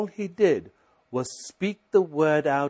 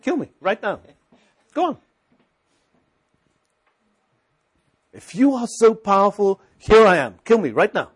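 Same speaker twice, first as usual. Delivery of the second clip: defiant challenge; 6 semitones higher, 7 dB louder than usual. Retold or imitated. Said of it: "And I stopped and I said".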